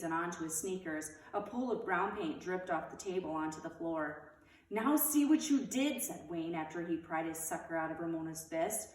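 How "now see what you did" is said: accusing. Acted. Wayne blaming Ramona.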